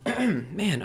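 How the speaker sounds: losing voice, hoarse and strained; worn-out hoarse voice. Real, not acted. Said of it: "I'm just losing my voice".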